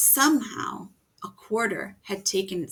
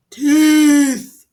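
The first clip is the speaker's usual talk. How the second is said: loud, high, greedy monster roar; monster yell; 6 semitones higher, 15 dB louder than usual. A narrator's reading. Tooth monster yelling at sleeping Norman, a loud, high monster roar. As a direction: bellow it like a hungry monster. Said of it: "the tooth monster yelled".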